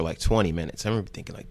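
ordinary talk, nothing marked

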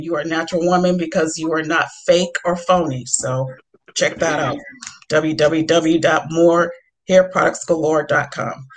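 ordinary talk

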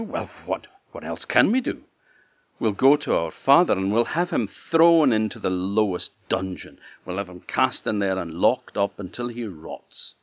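loud, hard-set vengeance; vengeful. Acted. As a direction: threaten with cold fury. Eldest sister plans revenge.

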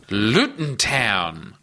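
slow careful echo of "Luton Town"; slowly repeated. Shown as a demonstration. Host echoing the slow pronunciation, very slowly. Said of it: "let's say that slowly".

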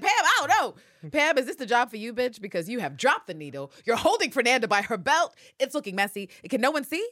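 loud, high, incredulous rant; exasperated outburst. Retold or imitated. Viewer watching Pam botch the kill, exasperated shouting. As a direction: yell it at a bungling amateur. Acting out reaction to messy fight.

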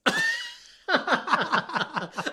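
high burst of laughter, loud; explosive high laugh; shrieking laugh. Real, not acted. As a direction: cackle at the joke. Big laugh after accent joke.

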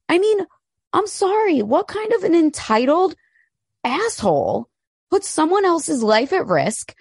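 indignant, voice climbing high; outraged. Real, not acted. Host outraged at Harry endangering driver, high indignant.

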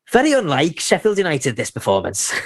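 smug Scouse commentator, lightly voiced; a hint of a TV pundit's Liverpool accent; Steve McManaman impression. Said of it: "Can you do the accent".

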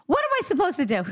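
high laughing question; amused spirit voice through a chuckle. Retold or imitated.

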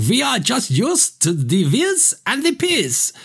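high, slow mock West Berlin German accent. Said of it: "West Berlin accent".

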